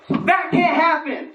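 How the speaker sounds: put-on high, slow, outraged old lady; indignant old lady. Old lady at the ATM refusing to believe, outraged. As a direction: drag it out in disbelief. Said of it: "It ate my card".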